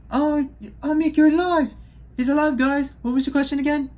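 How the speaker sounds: very high, excited New Zealand character voice; Korg's New Zealand accent. Acted. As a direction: exclaim in a squeaky-high Kiwi accent. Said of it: "That's this New Zealand accent".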